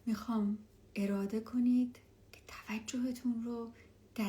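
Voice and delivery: hushed and calm, guide them down; spa whisper. Acted. Leads a meditation.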